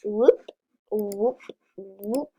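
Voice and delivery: normal talking voice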